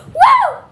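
very high, piercing "woo"; high loud whoop. Real, not acted. Kid overdoes it for headphone users, a high whoop. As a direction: let out a sudden loud whoop. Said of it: "sorry, headphone users".

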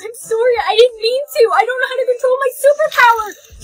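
high crying plea; tearful panic. Acted. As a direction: sob out the apology. Panicking about powers.

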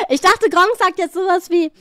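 ordinary delivery

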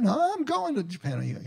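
high-pitched, half-hearted Scottish imitation; attempted Scottish accent. Imitating a friend set on his Japan trip, attempted Scottish accent. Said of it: "I can't say it in a Scottish accent".

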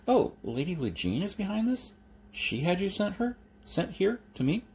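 nervous, surprised Jeremy. A narrator's reading. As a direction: blurt it out in surprise.

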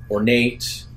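normal talking voice

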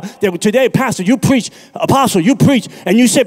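high, loud fire-and-brimstone preaching, climbing as it goes; full-force preaching. Acted. Preacher warning the congregation about hell, in full-force preaching. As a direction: preach it to the rafters, voice ringing.

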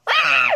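sudden high shriek of shock; shocked scream. Pretend. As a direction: scream at the missing wig. Discovers wig stolen, screams.

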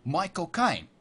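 Cockney impression, "Caine" turned to "kine"; unhurried Cockney with wide vowels; Michael Caine. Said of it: "That was a really good impression".

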